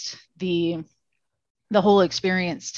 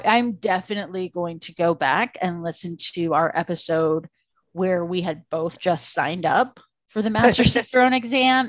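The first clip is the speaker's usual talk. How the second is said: usual speaking voice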